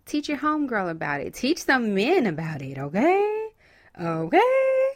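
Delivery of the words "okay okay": high, loud sing-song okay; playful okay. Real, not acted. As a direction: check in with a teasing lift.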